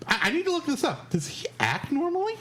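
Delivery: high-pitched, curious disbelief; amazed reaction. Retold or imitated. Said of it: "I'm sitting there going".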